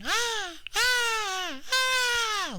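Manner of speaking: very high, drawn-out yelps; imitated high wail of a distressed mother; mock yelping. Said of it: "yelping again".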